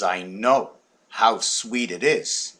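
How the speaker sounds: slow put-on voice. Lady's line, slow odd voice.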